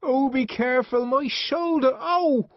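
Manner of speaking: high, loud cry of pain. A narrator's reading. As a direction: cry out as if hurt. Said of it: "Linda cried out".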